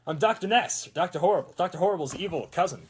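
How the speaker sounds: loud, high mad-scientist proclamation; hammed-up villain introduction; mock villain announcer. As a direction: announce yourself like a pretend supervillain.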